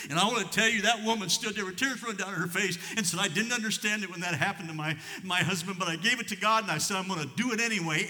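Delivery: loud, high preaching voice; fervent preaching. Acted. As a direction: preach it with rising fire to the congregation. Preaching at full force.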